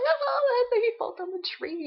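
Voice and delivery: high, loud mock amazement; imitating excited child. Child delighted by the wombat book, mock squealed excitement. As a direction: exclaim like a thrilled toddler. Child reacts to story, retold.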